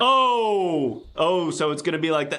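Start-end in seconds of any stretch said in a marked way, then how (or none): 0.0-1.0 offended gasp. Acted. Offended by fart remark.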